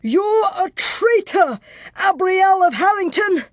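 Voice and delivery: furious high-pitched denunciation; strained accusing shout. Acted. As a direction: shout it with your last strength. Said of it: "gathered the last of his strength and shouted".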